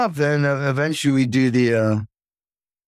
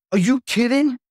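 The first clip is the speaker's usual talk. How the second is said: retold disbelief; 9 semitones higher than usual. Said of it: "And I'm like".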